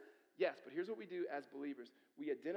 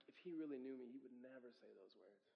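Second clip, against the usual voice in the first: much softer, almost to himself; quiet inner thought; 4 semitones lower, 11 dB quieter than usual. Retold or imitated. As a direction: murmur it like a private thought. Inner thought about coach's praise.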